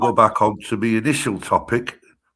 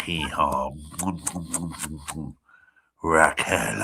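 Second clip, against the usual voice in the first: mock lover; 9 semitones lower, 6 dB quieter than usual. Tony serenading the AI mockingly.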